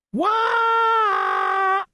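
very high, long wail; yelled reply. A narrator's reading. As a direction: holler back high, holding the vowel. Froggy answers the coach's yell.

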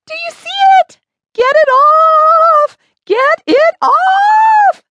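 very high, loud, drawn-out shrieking; terrified scream. A narrator's reading. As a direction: scream frantically, stretching the last words. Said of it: "screamed Bev".

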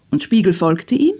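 ordinary delivery, nothing marked

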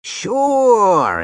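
high, drawn-out eager cry; eager agreement, stretched and pitched high; eager exclamation. A narrator's reading. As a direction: jump on it eagerly, pitch swooping up. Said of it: "exclaimed Withers eagerly".

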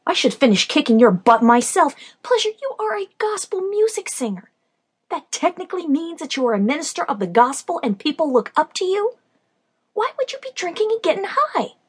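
high, loud scolding. Acted. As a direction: tear into her for getting high. Scolding her drunk friend.

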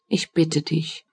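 soft, understated plaintive plea; almost tearful. A narrator's reading. Man begging his worried companion, almost tearful. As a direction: plead quietly, a touch tearful. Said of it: "mit fast weinerlicher Stimme".

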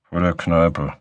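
lightly mumbled words; slight mumble. Acted. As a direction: mumble it a little. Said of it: "Er nuschelte leicht".

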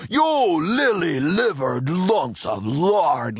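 slow, scornful pirate insult; lashing out. Acted. Pirate captain lashing out at his cowardly men. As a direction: spit each word at your cowardly crew. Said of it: "lashed out Dirty Joe".

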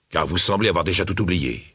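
ordinary delivery

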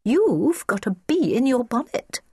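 high, deliberate little voice; high-pitched polite little girl; polite child. A narrator's reading. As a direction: explain it helpfully in a child's voice. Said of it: "said Christiana politely".

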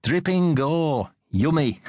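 ghoulish, savouring vampire voice; hammy vampire. A narrator's reading. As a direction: draw it out like a playful vampire. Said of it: "pulled her best vampire face".

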